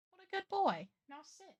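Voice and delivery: high cooing praise for a pet. Real, not acted. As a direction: praise the dog-like cat sweetly.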